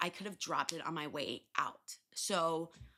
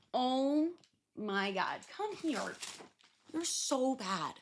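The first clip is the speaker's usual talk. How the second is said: cooing at pet; 6 semitones higher, slower than usual. Real, not acted. Cooing at pet after scolding.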